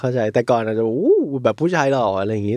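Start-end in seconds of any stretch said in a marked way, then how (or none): 0.9-1.1 high, drawn-out "ooh" of admiration; mock fangirl swoon. Imitating a younger viewer thrilled by a handsome man, mock swoon. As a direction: coo like an impressed fangirl, put on. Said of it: "อาจจะกรี๊ดกราดกับฉาก".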